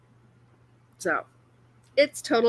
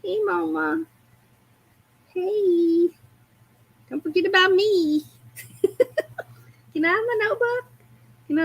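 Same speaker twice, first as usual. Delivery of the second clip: cat voice; 7 semitones higher than usual. Pretend.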